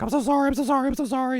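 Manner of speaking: high, loud mock apology; imitated panicky apologizer; frantic apologizing. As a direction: squeal apologies in a hammed-up panic. Agent apologizing over and over, retold.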